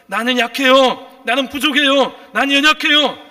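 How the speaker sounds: loud, high preacher's cry, building; impassioned confession. Acted. Voices believer's confession, rising force. (높고 크게 부르짖는 고백)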